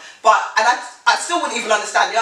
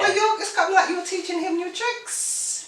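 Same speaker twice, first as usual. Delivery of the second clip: high, quick teasing voice; 12 semitones higher than usual. Acted. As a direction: needle her playfully, pitched up. Teasing cohost.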